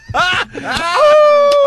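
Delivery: shrill burst of laughter, high and loud; high shrieking laugh. Real, not acted. Laughing hard at the Thailand squirrel joke, high shriek. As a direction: howl with laughter at the punchline.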